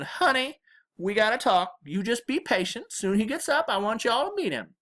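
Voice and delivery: high-pitched granny coaxing; amused Southern grandma. A narrator's reading. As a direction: put her off sweetly as an old Southern granny. Amused grandma puts off her daughter.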